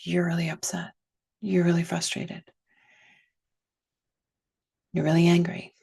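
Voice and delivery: name each feeling directly, pausing between them; direct, measured statements with pauses. Shown as a demonstration. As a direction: plainly direct validation. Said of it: "a little more direct".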